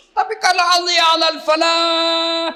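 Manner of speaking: high, loud pulpit voice; fiery preaching. Acted. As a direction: preach it with full force. Preaching at full force.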